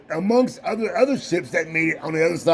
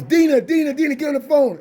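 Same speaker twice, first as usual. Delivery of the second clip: higher-pitched, urgent retold call; imitated calling out; 6 semitones higher than usual. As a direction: imitate dad calling someone to the phone. Dad calling Dina to the phone.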